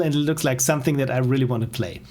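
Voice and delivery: normal talking voice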